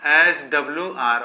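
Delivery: HWR drawn out slowly, letter by letter; fond nickname. Shown as a demonstration. Teacher to children, giving the pet name, slowly. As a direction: say the short name slowly for the notes. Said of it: "प्यार से बोलते हैं HW".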